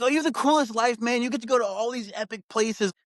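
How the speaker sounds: high mock-gushing fan voice. To the listener, mimicking envious fans, high mock gush. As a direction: parrot the envious fans in a high sing-song. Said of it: "And everyone's like".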